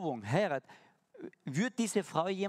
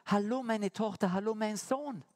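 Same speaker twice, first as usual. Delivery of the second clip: put-on higher, bright welcome to his children; cheerful fatherly hello, higher; glad greeting; 6 semitones higher than usual. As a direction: greet them like a delighted father. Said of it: "Und dann sagt er".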